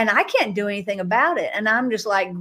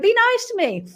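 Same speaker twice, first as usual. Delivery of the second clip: put-on childlike plea; 13 semitones higher, 4 dB louder than usual. Fifteen-year-old pleading with glaring judge.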